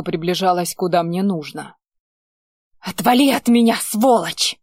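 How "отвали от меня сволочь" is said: raging shout; furious. A narrator's reading. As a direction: spit it out in a rage. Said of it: "бесилась Лите".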